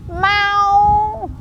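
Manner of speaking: long, high meow; drawn-out high mewing; cat meow. Shown as a demonstration. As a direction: call out like a cat, high and stretched. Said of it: "A little bit louder".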